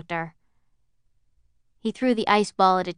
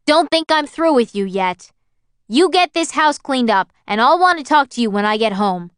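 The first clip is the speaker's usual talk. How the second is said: a father's raised, furious scolding, higher and louder; angry father; 5 semitones higher, 7 dB louder than usual. Acted. Father threatening his son over the wrecked house, angry raised voice. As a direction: bark the threat down at the kid.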